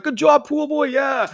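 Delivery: high-pitched imitation of a cheering crowd; mock cheering. Everyone at the party congratulating pool boy, mock cheering.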